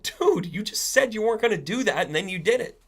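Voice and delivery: high-pitched, aggrieved frog; acted frog voice lifted in disbelief; protesting frog. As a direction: complain to the scorpion who betrayed you. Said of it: "And the frog is like".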